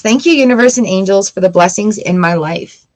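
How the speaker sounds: ordinary talk, nothing marked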